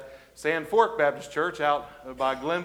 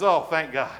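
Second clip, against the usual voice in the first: relieved sigh of thanks, usual pitch; mock relief. Rider safe at the cliff edge, mock relief. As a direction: let it out as a relieved punchline.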